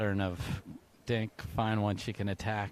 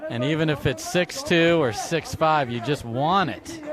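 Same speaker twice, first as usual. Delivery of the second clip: louder, higher excited call; excited commentary; 5 semitones higher, 8 dB louder than usual. Real, not acted. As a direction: build the hype as you call it. Excited call before timeout.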